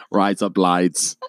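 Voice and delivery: slow, exaggerated Australian accent; Australian vowels, drawn out; mock Australian. Shown as a demonstration. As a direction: stretch it out in joke Australian.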